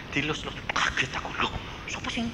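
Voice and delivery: hushed, breathless whisper of surprise; startled whisper. Retold or imitated. Preacher retelling being stroked in bed, startled whisper. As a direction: whisper as if someone touched your head in the dark.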